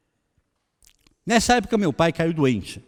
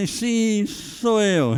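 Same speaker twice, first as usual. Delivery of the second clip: high, hesitant mock confession; acted sheepish yes; wary admission; 8 semitones higher, much slower than usual. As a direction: own up reluctantly, pitched well up. Wary answer to suspicious stranger.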